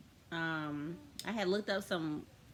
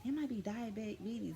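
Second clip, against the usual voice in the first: worried mom voice; 7 semitones higher than usual. Retold or imitated. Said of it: "My mom was like".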